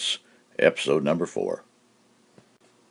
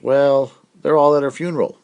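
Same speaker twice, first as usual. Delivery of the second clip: deadpan punchline; 10 semitones higher, 7 dB louder than usual. Acted. Punchline voiced by stranger.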